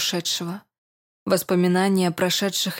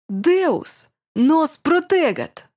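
high, loud and slow, arms raised to heaven; theatrical exclamation; 8 semitones higher, 4 dB louder, much slower than usual. Acted. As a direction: proclaim it like a grand stage prayer. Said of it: "Театрально воскликнул диакон".

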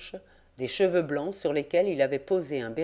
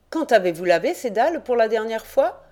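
higher, louder probing question; sharp questioning; 6 semitones higher, 6 dB louder than usual. A narrator's reading. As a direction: press the old man. (le jeune reporter interroge vivement)

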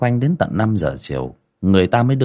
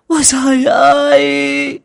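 shrieking lament; 7 dB louder than usual. Acted. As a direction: cry out to heaven in hammed-up grief. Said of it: "Mụ Vũ quỳ xuống lại chu chéo". (chu chéo gào khóc thảm thiết)

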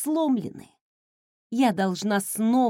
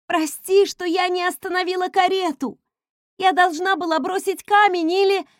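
upset friend; 8 semitones higher, 5 dB louder than usual. Acted. Piper's guilty outburst.

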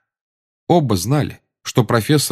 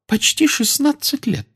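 high, grudging seer's voice; displeased Trelawney. A narrator's reading. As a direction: answer with reluctant displeasure. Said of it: "с большим недовольством сказала".

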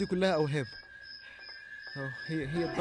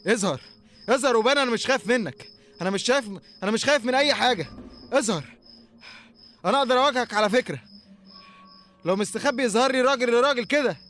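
loud, high challenge to come out; defiant shouting; 5 semitones higher, 9 dB louder than usual. Acted. Man in the dark shouting at his stalker, defiant. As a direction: yell to prove he isn't scared.

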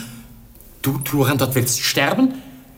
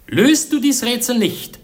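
forceful challenge; 9 semitones higher, 5 dB louder than usual. Acted. Prince sets his challenge.

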